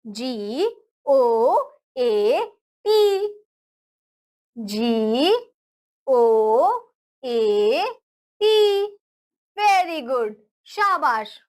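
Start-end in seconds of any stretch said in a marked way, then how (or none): 0.0-8.9 slow spelling out. Shown as a demonstration. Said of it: "गोट की spelling क्या होती है?".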